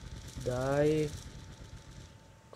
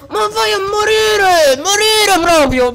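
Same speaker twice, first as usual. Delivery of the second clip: higher, louder raging cry; furious yell; 10 semitones higher, 4 dB louder than usual. Real, not acted. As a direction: scream it at the enemy. Gamer raging at enemy. (urlo rabbioso)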